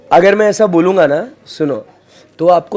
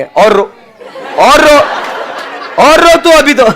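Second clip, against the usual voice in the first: mock scolding; 7 semitones higher than usual. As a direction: egg her on in playful mock anger. Teacher mock-scolding crying student.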